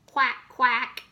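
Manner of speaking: loud put-on quack; duck quack. George answering his mother, loud duck quack.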